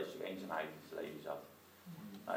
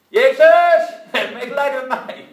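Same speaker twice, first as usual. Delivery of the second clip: even louder desperate cry; 23 dB louder than usual. Retold or imitated. Blind man crying out still louder to Jesus. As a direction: shout it out harder than before with full force. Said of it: "hij riep nog harder".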